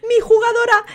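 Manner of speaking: high, wailing mock grief; mock lament. Host mourns her fallen player, mock wailing. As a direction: wail over the loss, hammed-up.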